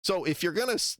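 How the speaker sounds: ordinary talk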